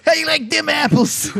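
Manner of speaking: high, fast hammed-up Boston accent; a bad Boston tough-guy voice. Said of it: "That's so bad".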